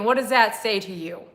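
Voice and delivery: ordinary talk, nothing marked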